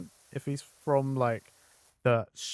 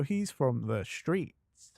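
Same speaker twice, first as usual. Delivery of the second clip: put-on Cockney accent; 3 semitones lower than usual. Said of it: "make him sound more Cockney".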